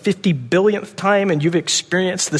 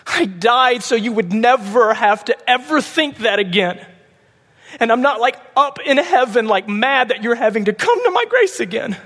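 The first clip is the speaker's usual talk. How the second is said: Jesus pleading; 9 semitones higher than usual. Acted. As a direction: cry out stop like Jesus pulling someone back from despair. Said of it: "Jesus is there saying".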